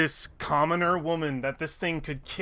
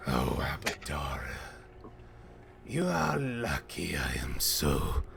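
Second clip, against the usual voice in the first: smirking monster; 12 semitones lower, 7 dB quieter than usual. Acted. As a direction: savour the victory with a smirk you can hear. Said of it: "it's got a smirk on its face".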